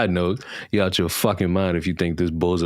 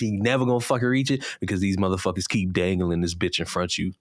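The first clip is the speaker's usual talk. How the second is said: usual speaking voice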